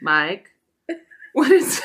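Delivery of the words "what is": high, loud burst of laughter; breaking up mid-question; laughing. Real, not acted. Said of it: "laughs mid-question".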